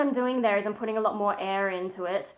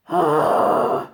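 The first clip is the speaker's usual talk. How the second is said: loud, higher sigh full of air; airy false chord sigh; 5 semitones higher, 6 dB louder than usual. Shown as a demonstration. Demonstrating the airier version, loud sigh. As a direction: put more air into the sigh. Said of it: "put a bit more air into it".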